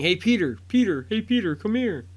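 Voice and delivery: loud, high calls across a distance; calling out. Retold or imitated. Friends calling Peter to come over, loud high calls. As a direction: shout up to get his attention. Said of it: "And they start calling".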